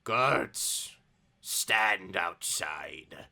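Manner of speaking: very slow, low rasp; very raspy old man. Acted. Old leader dismissing his guards, slow and raspy. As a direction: draw out the order in an ancient rasp. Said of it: "he speaks in a very raspy voice".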